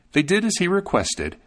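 ordinary delivery

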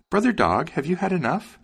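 high-pitched little bird character; high sparrow asking with concern. A narrator's reading. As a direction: ask in a high, lilting bird voice. Sparrow checking on the dog.

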